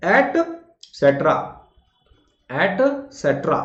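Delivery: एटसेटरा drawn out slowly as "et-set-ra"; slow correct pronunciation. Shown as a demonstration. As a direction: slowly model एटसेटरा syllable by syllable. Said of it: "बोलकर देखिए".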